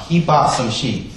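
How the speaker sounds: normal talking voice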